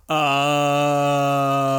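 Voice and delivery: a drawn oh of getting the pun; slow dawning oh; realising oh. Real, not acted. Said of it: "Yeah, Fish Fry".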